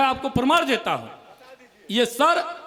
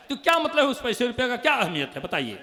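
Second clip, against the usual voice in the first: ordinary talk, nothing marked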